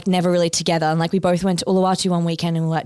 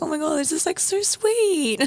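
choked-up gush; 9 semitones higher, slower than usual. Retold or imitated. Retelling reading her ex's breakup post, touched and teary. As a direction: stretch the sweet out as if about to cry. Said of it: "like, crying".

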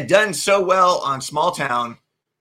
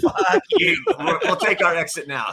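joking brush-off through laughter; laughing mock refusal; 5 semitones higher than usual. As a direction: turn down the pitch in hammed-up, laughing outrage. Acted mock refusal of studio pitch.

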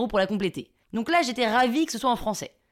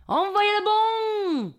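high, slow southern French holler; hammed-up southern French accent; 9 semitones higher, much slower than usual. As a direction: bellow a bad imitation of Marseille accent. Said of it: "Je prends mon plus bel accent du Sud". (faux accent du Sud, crié et traînant)

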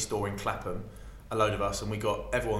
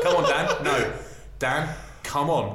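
higher, louder cajoling, slowing at the end; insistent coaxing; 4 semitones higher, 5 dB louder, slower than usual. Retold or imitated. MC urges reluctant Dan onto the mic, loud coaxing. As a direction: coax him up with growing insistence.

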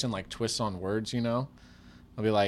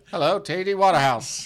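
louder, higher stuffy greeting; old-money greeting; 8 semitones higher, 10 dB louder than usual. Pretend. Bill and Ted's time-traveler greeting a banker, stuffy and loud. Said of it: "he's like".

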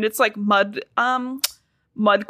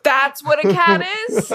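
mock amazement; 6 semitones higher, 4 dB louder than usual. Mocking authors seeing cat.

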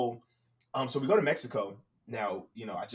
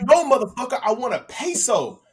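going off; 9 semitones higher, 8 dB louder than usual. Retold or imitated. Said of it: "I start going off on this dude".

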